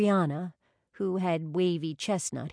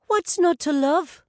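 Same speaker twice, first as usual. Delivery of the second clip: higher, louder grinning reply; 11 semitones higher, 8 dB louder than usual. A narrator's reading. Brianna grinning back at Rachel, higher and louder. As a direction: toss it off with a grin.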